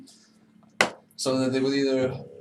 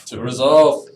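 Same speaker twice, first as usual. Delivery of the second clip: loud toast; 13 dB louder, much slower than usual. Real, not acted. Player toasting the party's new team name, loud cheer. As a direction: raise the glass and call it out.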